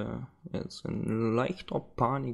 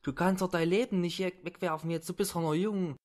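higher, pleading thug voice; 5 semitones higher than usual. Acted. Thug begs the woman who kidnapped him, pleading and higher. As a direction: wheedle and beg to be let go.

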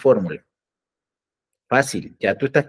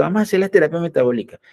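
higher, faster mock chorus; all the doctors chiming in eagerly; 7 semitones higher, faster than usual. All doctors jump on the bandwagon, in a fast, higher mock chorus. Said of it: "y todos los médicos".

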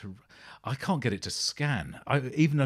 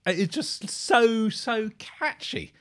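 high, loud gushing praise; 9 semitones higher, 5 dB louder, slower than usual. Real, not acted. Reacting to a song, gushing high and loud. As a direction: swoon over how catchy it is.

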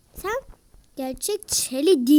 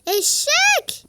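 squeaky taunt; 13 semitones higher, 6 dB louder than usual. Real, not acted.

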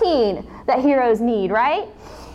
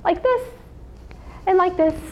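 high bright demo; 6 semitones higher, 3 dB quieter than usual. Shown as a demonstration. Showing a stretch.

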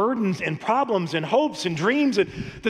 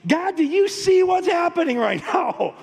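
much higher, louder cry, breaking into a laugh; laughing exasperated plea; 10 semitones higher, 3 dB louder than usual. Retold or imitated. Pastor retells begging God during the toddler's car meltdown, higher, louder, laughing. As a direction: plead to heaven in mock despair, laughing.

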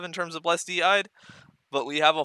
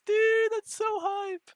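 high, slow delighted exclamation; excited squeal; 3 dB louder, slower than usual. Real, not acted. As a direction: gush with joy. Thrilled at teleports.

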